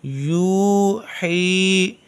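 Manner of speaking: यू ही held very long and slow; slow recitation drill; drawn-out vowels. Shown as a demonstration. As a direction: stretch the vowels of यू ही. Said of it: "हा या ज़ेर ही".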